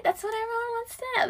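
high, soft mock-gossipy voice; teasing. Podcaster teasing listeners with the tea, high and coy.

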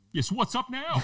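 very high squeaky bravado; mock tough. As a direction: taunt shrilly from behind someone's back. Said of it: "now I'm all tough".

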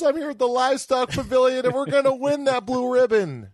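high, loud put-on character voice; movie quote voice. Quoting a movie about the livestock pavilion, hammy character voice.

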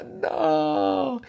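high, drawn-out storytelling cry; grito agudo. Retold or imitated. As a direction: wail a long high "no" as the character. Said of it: "ella grita". (grito agudo y alargado de "no")

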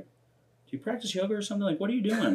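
call-center coworkers asking about his breathing, high and curious. Retold or imitated. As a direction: lift it up like a curious stranger. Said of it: "And so they're like".

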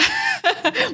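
laughing. Real, not acted. Host laughs at drunk joke.

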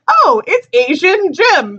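high, drawn-out happy recognition; delighted recognition. Retold or imitated. Fan recalls seeing him in Ant-Man, delighted recognition. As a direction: reenact spotting a favourite, high and slow.

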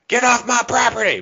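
high, loud mock outburst; hammed-up angry yell. Florida man answering a stranger at his door, hammed-up angry yell. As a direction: yell it like a riled-up local.

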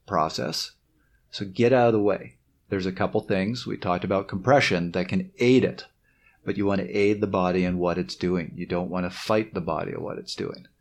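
ordinary talk, nothing marked

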